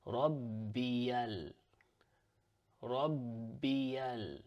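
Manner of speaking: Rabbiyal run together, the l linking into the next word; Arabic liaison Rabbiyal; joined words. Shown as a demonstration. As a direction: link Rabbiyal smoothly into the L. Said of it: "it's gonna sound like this".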